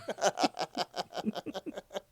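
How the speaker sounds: a real high giggle, barely voiced; high breathy giggle. Real, not acted. Reading fanfic to a friend, a real high giggle. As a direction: giggle helplessly at the silly fic.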